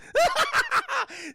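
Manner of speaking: loud high villain cackle; hammed-up shrieking laugh; Joker. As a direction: cackle madly at your own trap. Joker's cackle after his boast.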